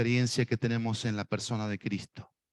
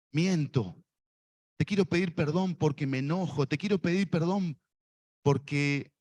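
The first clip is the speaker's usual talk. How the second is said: led prayer; 3 semitones higher than usual. Shown as a demonstration. Preacher leading the congregation in prayer, fervent. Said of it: "Decile así a Dios".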